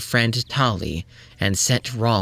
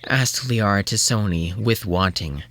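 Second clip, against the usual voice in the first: ordinary delivery, nothing marked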